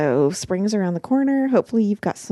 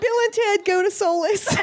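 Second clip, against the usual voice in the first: laughing; 12 semitones higher than usual. Real, not acted. Joking Bill and Ted voice.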